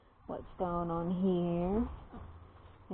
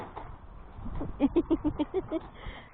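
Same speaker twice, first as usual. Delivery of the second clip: breathy laugh; 9 semitones higher than usual. Real, not acted. Laughs at the penguin.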